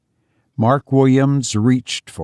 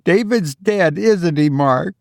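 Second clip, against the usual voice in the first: higher, controlled woman voice; mother voice; 5 semitones higher than usual. Acted. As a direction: ask steadily, higher. Mother asking.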